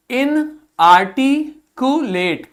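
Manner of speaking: इनआर्टिकुलेट spoken very slowly, syllable by syllable; slow model reading. Shown as a demonstration. Teacher showing the class a new word, slow and deliberate. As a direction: draw out इनआर्टिकुलेट for pupils to repeat. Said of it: "आगे देखिए".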